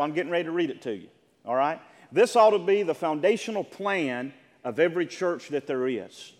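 ordinary talk, nothing marked

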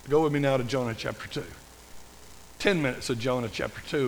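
usual speaking voice

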